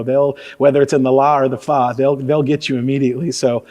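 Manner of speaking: usual speaking voice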